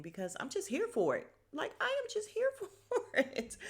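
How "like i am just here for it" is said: high squeal through laughter; laughing. Real, not acted. Vlogger gushing about the actor, laughing high. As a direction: gush it out laughing. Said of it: "laughs while saying it".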